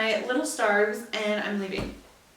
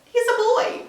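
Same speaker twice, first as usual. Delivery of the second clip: mimicking people; 11 semitones higher, 3 dB louder than usual. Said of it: "Everyone's like".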